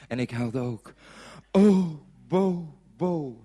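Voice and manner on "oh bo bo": loud opening wail that sinks away; hammed-up tearful call of a name; mock crying. A narrator's reading. Traveller to his girlfriend at parting, mock tearful. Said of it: "huilde ik".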